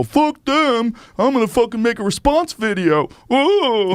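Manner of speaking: high-pitched hammed-up tantrum; mock outrage. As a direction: overreact like a wounded hothead, pitch way up. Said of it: "not taking you seriously? Do you go".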